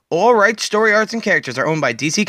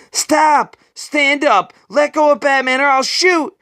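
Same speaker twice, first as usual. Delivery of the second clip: calling out; 8 semitones higher than usual. Acted. Doctor orders the attacker off Batman, shouting high. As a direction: shout her down to stop him. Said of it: "calls out".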